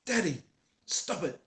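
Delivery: put-on high, loud little child's plea; a two-year-old telling daddy off; toddler voice. Toddler to his arguing dad, high and loud. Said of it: "my two-year-old goes".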